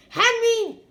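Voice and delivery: loud, high warning call; Dad yells at Henry to stop, loud and high; yelling. A narrator's reading. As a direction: yell it at the boy. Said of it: "yelled Dad".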